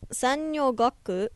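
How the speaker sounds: sanyogaku said carefully in Japanese, sa-nyo-ga-ku; Japanese pronunciation. Shown as a demonstration. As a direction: sound out sanyogaku syllable by syllable. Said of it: "is how you say it in Japanese".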